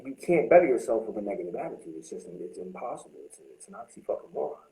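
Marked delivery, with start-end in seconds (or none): none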